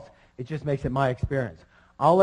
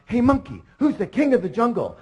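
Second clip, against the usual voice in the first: higher-pitched challenging lion call; bossy lion; 8 semitones higher than usual. Acted. Lion challenging a monkey in a joke, bossy and high. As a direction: demand an answer like a cocky lion. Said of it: "And he goes".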